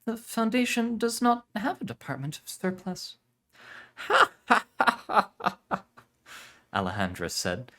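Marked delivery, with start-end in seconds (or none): 0.1-3.2 put-on much higher, puzzled young woman. Aurelia objects. 4.0-5.8 high, loud theatrical laughter; showy laugh. Acted.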